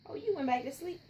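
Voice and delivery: normal talking voice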